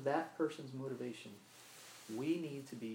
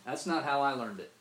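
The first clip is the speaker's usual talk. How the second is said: louder, curt brush-off stressing not; dismissive retort; 3 semitones higher, 8 dB louder than usual. Retold or imitated. Preacher voices the stock reply to unwelcome truth, loud dismissive retort. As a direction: throw it out like a shrug-off. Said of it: "that's a phrase we just throw out there".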